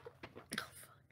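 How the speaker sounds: usual speaking voice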